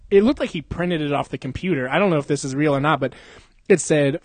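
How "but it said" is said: ordinary talk, nothing marked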